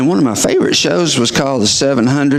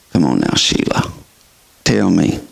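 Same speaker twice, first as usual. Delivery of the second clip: much lower, gentle push; low coaxing; 8 semitones lower than usual. Retold or imitated. Doctor coaxing Sheila, low and gentle. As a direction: coax her, deep and patient.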